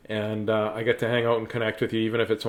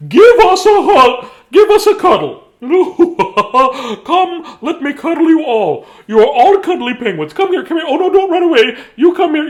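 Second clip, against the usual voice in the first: very high, loud, gushing character voice; gushing penguin-hugger; 14 semitones higher, 11 dB louder than usual. Acted. Story character begging the penguins for cuddles, high and gushing. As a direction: squeal it out like someone desperate to hug every penguin.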